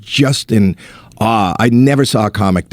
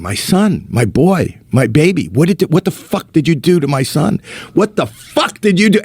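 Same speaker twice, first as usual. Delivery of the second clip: retold screaming; 6 semitones higher than usual. Comic playing God demanding his son back, retold screaming. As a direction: imitate a father's frantic outcry, pitch climbing. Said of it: "he just starts screaming".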